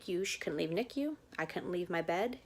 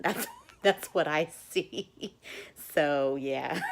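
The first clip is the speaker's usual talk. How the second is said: laughing; 7 dB louder, slower than usual. Real, not acted. Laughs at blood on shield.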